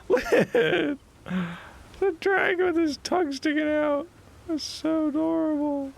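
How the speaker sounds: high cooing voice over a cute creature. Real, not acted. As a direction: coo at it like a pet.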